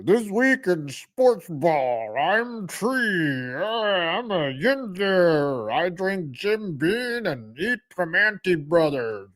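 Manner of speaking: slow, put-on Pittsburgh yinzer announcer voice; mock yinzer sports host; Tree impression. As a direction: drawl it like a lazy local sports host. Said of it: "I don't have a deep voice like".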